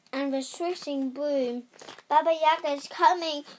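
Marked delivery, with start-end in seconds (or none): 2.1-3.5 fast, raised alarm call; urgent shout, quick and higher; cried warning. A narrator's reading. Doll alerting Tessa to the chasing witch, crying out. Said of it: "cried the little doll".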